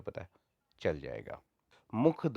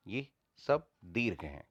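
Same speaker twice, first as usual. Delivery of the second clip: normal talking voice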